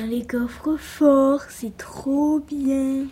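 slow, breathless whisper. Real, not acted. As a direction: hush it out slowly. Child whispering excitedly at bedtime.

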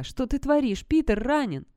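high, loud protest; furious yell. A narrator's reading. Sarah fights Roland's grip, high furious yell. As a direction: yell in outrage at being held back. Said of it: "яростно завопила я".

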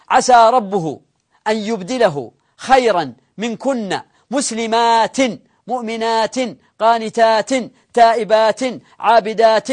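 measured, deliberate verse recitation; verse recited word group by word group; Quran recitation. Acted. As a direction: recite slowly, sounding every -in ending.

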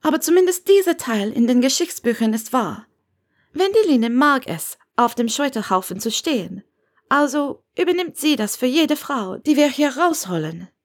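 high, mildly reproachful Granger figure; a bit reproachful. A narrator's reading. Granger explains Wendeline's role at the stake, a bit reproachful. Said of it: "klang ein bisschen vorwurfsvoll".

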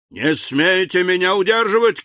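high-pitched angry cry; shouting. A narrator's reading. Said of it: "кричал старик". (гневный крик старика)

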